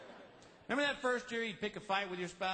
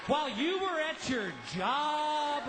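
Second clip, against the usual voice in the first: high-pitched, hammed-up furious scream; screaming wife; 9 semitones higher than usual. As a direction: shriek it at your husband, drawing out the end. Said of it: "Your wife screams".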